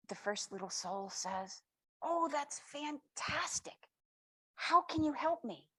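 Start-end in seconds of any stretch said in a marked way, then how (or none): 2.0-5.7 high, slow, thrilled and rising; delighted. Acted. First little soul thrilled at the offer of help, high and delighted. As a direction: gasp it out like the best news ever.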